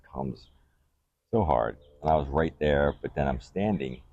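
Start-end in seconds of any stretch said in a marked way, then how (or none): none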